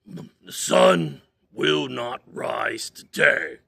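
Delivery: slow, loud grumpy command. Acted. Bear ordering the sun not to rise, slow and grumpy. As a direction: order the sun slowly, grumbling. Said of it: "a little grumpily".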